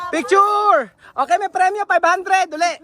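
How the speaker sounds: high call to gather the group. Real, not acted. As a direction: call them over for a photo.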